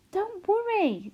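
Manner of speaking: gently calm her down, drawn out; reassuring girl. A narrator's reading. Daughter soothing her worried mother, high and slow.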